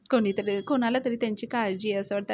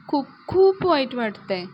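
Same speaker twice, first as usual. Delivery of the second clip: "खूप, खूप" pushed higher and much louder; emphatic hurt; 4 semitones higher, 8 dB louder than usual. A narrator's reading. Girl telling her friend how hurt she is, emphatic. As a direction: lean hard on each "खूप", voice lifted.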